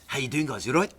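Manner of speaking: put-on high, chirpy South African waiter accent; South African accent, pitched well up; imitated South African accent. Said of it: "That's a good accent".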